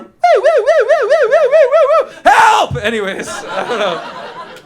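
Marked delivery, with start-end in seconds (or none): 0.0-2.1 high loud wailing siren whoops; imitated siren, high and loud; car alarm. Shown as a demonstration. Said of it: "Car alarm". 2.2-2.8 loud high yell for help; a hammed-up call for help; mock cry for help. Cry after the alarm.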